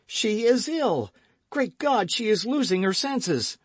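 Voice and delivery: high, loud and panicked; frantic alarm. Acted. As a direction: cry out in fear for her. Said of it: "cried D'Artagnan".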